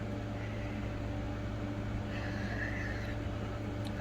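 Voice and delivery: long breathy yawn; big yawn. Real, not acted. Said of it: "I was yawning".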